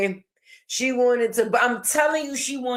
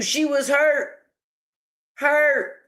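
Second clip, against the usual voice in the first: emphatic; 2 semitones higher, slower than usual. Real, not acted. Emphatic report.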